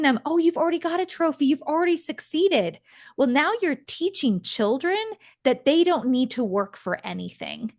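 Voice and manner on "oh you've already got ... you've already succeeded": high, fast mock-gushing parent voice; gushing praise. Imitating a parent gushing over a child, mock-sweet. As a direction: rattle it off like a doting parent.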